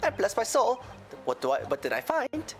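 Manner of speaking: a lilting Irish brogue; Irish accent. Acted. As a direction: tell it like an Irish witness. McGilded testimony in accent.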